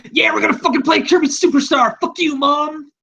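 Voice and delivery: high goofy cartoon voice, overly excited; Shmorky's cartoon voice. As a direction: mimic a hyped-up cartoon kid yelling at his mom. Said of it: "you can just hear Shmorky go".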